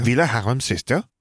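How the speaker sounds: high, perky chirp. A narrator's reading. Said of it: "Gabby chirped".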